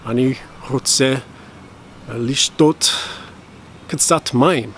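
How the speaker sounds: "Ani hotse lishtot katsat mayim" spoken slowly, word by word; slow Hebrew demo. Shown as a demonstration. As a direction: spell out each Hebrew word slowly for learners. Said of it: "I wanna drink some water".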